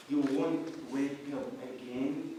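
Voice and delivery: usual speaking voice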